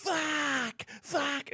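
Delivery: high, pained mock swearing; imitating the burning flatmate. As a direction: swear like a man whose skin is on fire. Flatmate back from the shower.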